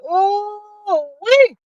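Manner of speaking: high excited whoop for [name]; cheering [name] on. Retold or imitated. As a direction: cheer her on like you're in the crowd. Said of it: "I said".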